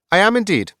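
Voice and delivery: high, loud, pompous sword voice; a proud enchanted blade chiming in; the sword Pointy answers the question proudly; talking sword. Acted.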